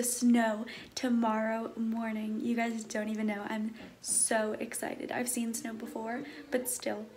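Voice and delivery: hushed, slow and voiced. Real, not acted. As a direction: keep it low but voiced.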